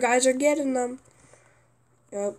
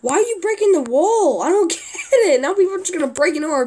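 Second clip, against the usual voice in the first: exasperated yell; 7 semitones higher, 6 dB louder, faster than usual. Real, not acted. Teammate breaking the wall.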